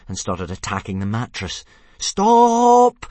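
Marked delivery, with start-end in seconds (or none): 2.0-3.0 high, full-volume yell; loud, alarmed shout. A narrator's reading. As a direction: yell it out, drawn long. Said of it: "yelled Hiccup".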